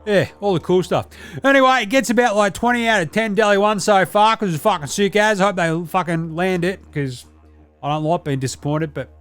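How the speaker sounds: a brash, sweary ocker lad; Deli character. Acted.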